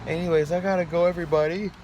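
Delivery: high, loud, upbeat goodbye; bright sign-off. Real, not acted.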